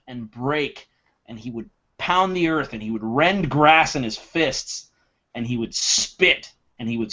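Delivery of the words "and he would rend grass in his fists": ordinary talk, nothing marked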